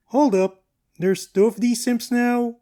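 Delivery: loud, high double take; surprised. Real, not acted. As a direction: react like you can't believe it. Surprise at duplicate character.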